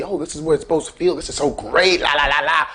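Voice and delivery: quick, airy mock-happy self with a laugh; carefree bliss. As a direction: breeze through it like nothing's wrong, chuckling. Blissful self before the panic.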